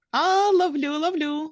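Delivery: high, eager squirrel voice; squirrel catching. A narrator's reading. Curie catching the baby birds, high and eager. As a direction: cry it out while catching. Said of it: "сказала Кюрі".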